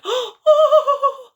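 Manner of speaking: very high loud startled squeal; frightened cry. Acted. Goldilocks crying out in fright at the bears, high and loud. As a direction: shriek in fright. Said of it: "And Goldilocks went".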